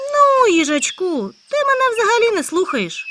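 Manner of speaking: the hare let down by his friend; disappointed hare. Acted. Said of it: "відповів розчарований заєць". (розчарований заєць, високо й повільно)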